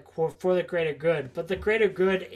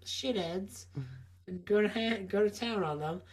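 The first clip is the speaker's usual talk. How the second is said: softer Cockney orc, tailing away; Cockney accent; 8 dB quieter than usual. Acted. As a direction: keep up the mock Cockney brawler, quieter. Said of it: "talk with a Cockney accent".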